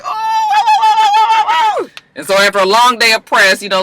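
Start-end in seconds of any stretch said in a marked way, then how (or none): 0.0-1.9 high excited yelping "oh, oh"; excited shout. Real, not acted. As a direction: shriek out each "oh" with glee. Host reacts, Melly about to fight.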